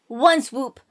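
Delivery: normal talking voice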